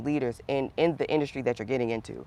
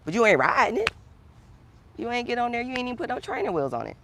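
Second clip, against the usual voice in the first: raised, coaxing bike pep talk; emphatic high push; 9 semitones higher, 4 dB louder than usual. Real, not acted. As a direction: urge them on, pitched well up.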